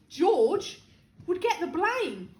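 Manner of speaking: usual speaking voice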